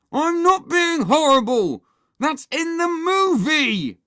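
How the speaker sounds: slow, high, very loud denial; protesting shout. Acted. Henry lies back to Mum, shouting. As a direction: yell your innocence back.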